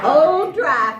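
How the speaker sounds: put-on Old Dry Fry called out loud and slow; loud reveal. Shown as a demonstration. Storyteller leads the audience chorus, loud and slow. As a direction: boom out Old Dry Fry for the crowd to echo. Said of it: "Say it one more time".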